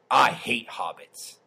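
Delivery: low, slow deadpan declaration; dead serious. Retold or imitated. Jenna declaring her hatred of hobbits, dead serious. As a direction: state it gravely, slow and low. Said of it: "just dead serious".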